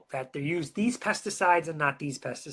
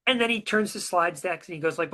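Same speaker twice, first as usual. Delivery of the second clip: ordinary talk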